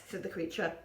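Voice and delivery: ordinary delivery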